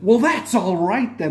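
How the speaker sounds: a smug villain toying with the Doctor, slow and gloating; smug Toymaker. Retold or imitated. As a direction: savour each word like a gleeful trickster. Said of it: "the Doctor went".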